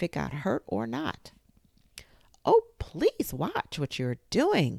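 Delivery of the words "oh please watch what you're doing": high, loud, slow alarmed plea; crying out. Acted. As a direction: cry out in alarm to stop them. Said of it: "cried Alice".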